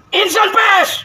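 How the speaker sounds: shouted rally call, pitched high. Real, not acted. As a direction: rouse the crowd with a shouted call. Orator leads crowd chant.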